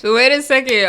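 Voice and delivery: ordinary talk, nothing marked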